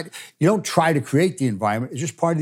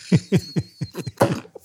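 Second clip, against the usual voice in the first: laugh; 5 semitones higher than usual. Real, not acted. Laughing at the stepson's answer.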